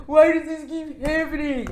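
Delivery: mock flat, unbothered complaint; deadpan Ethan. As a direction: say it like nothing's really wrong. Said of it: "he's still all deadpan 'cause he's like".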